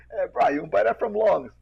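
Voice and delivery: high, loud pidgin banter; local pidgin banter. Retold or imitated. Two locals joking about rubber slippers. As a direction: tease each other like island braddahs.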